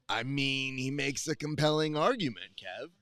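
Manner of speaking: ordinary delivery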